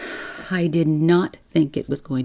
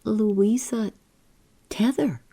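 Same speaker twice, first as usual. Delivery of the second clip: stupidly; 6 semitones higher than usual. A narrator's reading.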